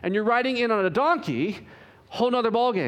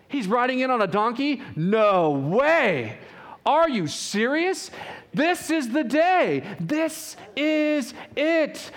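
excited crowd; 6 semitones higher, slower than usual. Retold or imitated. Jerusalem crowd seeing Jesus ride in, excited and higher. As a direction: cry out like a crowd that can't believe its eyes.

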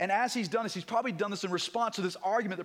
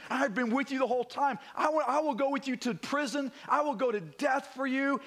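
higher-pitched, eager declaration; emphatic vow; 7 semitones higher than usual. Retold or imitated. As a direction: vow it with fervent conviction. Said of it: "you can hear this emphatic statement".

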